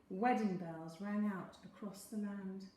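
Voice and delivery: plain reading voice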